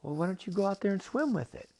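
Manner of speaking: high, brisk suggestion from an octopus; high cartoon octopus; talking octopus. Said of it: "And the octopus says".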